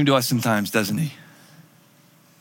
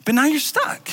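higher, exasperated honesty; blunt unsaid truth; 7 semitones higher than usual. Retold or imitated. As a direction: say what nobody dares to say. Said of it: "it's like".

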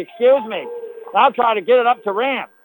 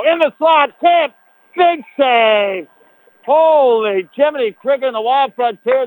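excited shout; 3 semitones higher than usual. Real, not acted. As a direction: yell the big save. Tip and big save.

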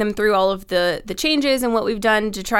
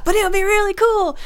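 very high, sing-out pleading; tempted inner voice; 12 semitones higher, 4 dB louder than usual. Retold or imitated. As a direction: wheedle yourself into it, high-pitched. Tempted inner voice argues back.

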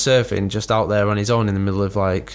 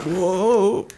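startled; 9 semitones higher than usual. Retold or imitated. Falling off board.

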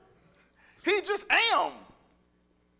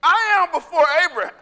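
loud, high, quick outburst; booming proclamation; 6 semitones higher, 9 dB louder, faster than usual. Acted. Jesus answering the Pharisees' doubt, booming proclamation. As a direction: cry it out as a bold claim.

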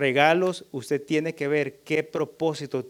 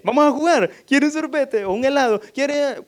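much higher, louder, overly bright coaxing; jolly her out of tears; father coaxing his upset daughter, sing-song; cheerful distracting dad; 11 semitones higher, 9 dB louder than usual.

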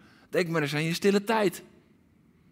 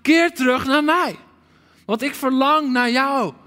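high, loud call of Keer; heart cry; 6 semitones higher, 5 dB louder than usual. Acted. Preacher voicing God in confrontation, loud pleading. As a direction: plead at full voice. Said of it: "klinkt zijn hart".

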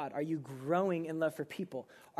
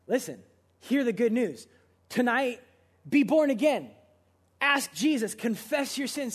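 higher and louder, pleading the crowd; rising altar-call fervor; impassioned appeal; 6 semitones higher, 6 dB louder than usual. Real, not acted. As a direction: call them to Jesus tonight with full force.

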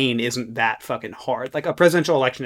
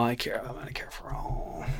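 whispering; 2 semitones lower, 15 dB quieter than usual. Real, not acted. Quick correction after joke.

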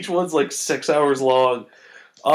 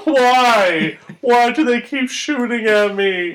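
whiny Hulk; 10 semitones higher, 6 dB louder, much slower than usual. Retold or imitated. Mocking the Hulk's confused plea.

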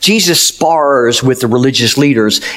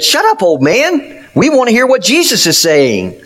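sharp rebuke pitched much higher; crowd telling him to hush; 8 semitones higher than usual. Retold or imitated. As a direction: hush the beggar sharply, voice jumping up. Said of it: "those near him said".

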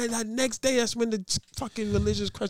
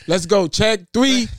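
louder score call; 7 dB louder than usual. Pretend. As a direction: announce the score to him. Calls score in pretend game.

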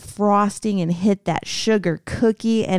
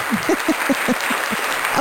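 airy put-on fake laugh; 4 semitones higher than usual. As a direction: let out a breathy fake laugh. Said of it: "I like my fake laugh".

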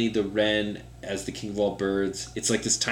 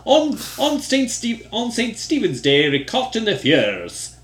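laughing attempt at an Irish accent; bad Irish accent; 10 semitones higher, 4 dB louder than usual. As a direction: start high and giggly in a botched Irish brogue. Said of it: "That is so bad".

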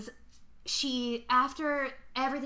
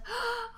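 gasp; 13 semitones higher than usual. Retold or imitated. Angela looks over the edge.

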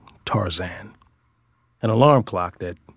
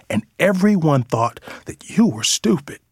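higher, insistent mother's plea; impassioned rebuke; 8 semitones higher than usual. Acted. Mother defending a son to his twin sister, impassioned. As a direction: press the point with rising pitch. Deb rebukes daughter for calling brother stupid.

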